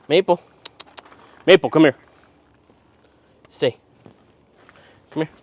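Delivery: loud high call across the yard; calling the dog. Real, not acted. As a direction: call the dog's name loudly.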